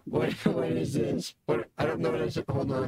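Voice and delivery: distorted robotic demon filter; warped through a voice modulator. Real, not acted. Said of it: "sounds like a robo demon".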